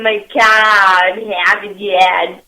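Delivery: put-on slow, laughing Boston accent. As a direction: stretch it out Boston style. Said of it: "Alex Trebek trying to do the Boston accent".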